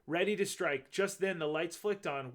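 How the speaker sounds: plain reading voice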